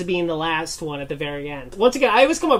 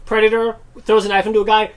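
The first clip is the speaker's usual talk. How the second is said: normal talking voice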